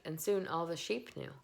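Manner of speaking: ordinary delivery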